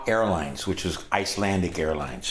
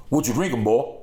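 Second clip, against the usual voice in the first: higher, louder bluesman impression; 4 semitones higher, 4 dB louder than usual. Musician to the young teller, imitated gruff questioning. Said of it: "and he goes".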